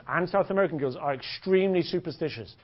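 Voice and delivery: usual speaking voice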